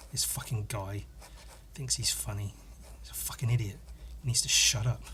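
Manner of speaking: hushed, muttered whisper of contempt; whispered insult. Real, not acted. Whispering to himself about a commenter, scornful. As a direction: whisper it under your breath, scornful.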